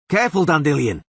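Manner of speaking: loud, sharp warning shout. A narrator's reading. Witcher shouts a warning at Dandelion, loud and urgent. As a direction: yell a warning to his friend. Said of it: "shouted the Witcher".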